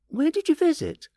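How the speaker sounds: put-on high curious boy voice. A narrator's reading. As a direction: ask like an eager kid. Boy asking uncle.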